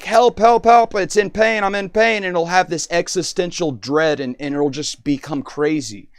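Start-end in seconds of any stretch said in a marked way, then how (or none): 0.0-2.2 higher, louder imitated cries for help; imitating an AI suddenly in distress; mock panic. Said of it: "randomly suddenly start being like".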